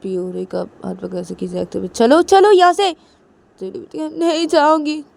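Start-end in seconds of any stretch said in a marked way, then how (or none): 1.9-2.9 high, very loud command; angry shout. Acted. Piu dragging Rui out, angry shouting. 4.2-5.0 high cry of protest; loud refusal. Acted. Rui resisting being dragged out, loud refusal.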